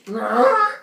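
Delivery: loud, high imitated bull bellow; explosive cartoon animal cry; crazed bull roar. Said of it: "The bull goes".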